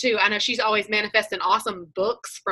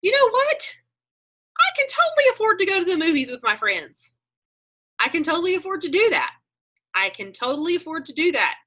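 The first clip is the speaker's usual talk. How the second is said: eager inner voice; 7 semitones higher than usual. Retold or imitated. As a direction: pitch way up and sell it to yourself. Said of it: "and I'm like".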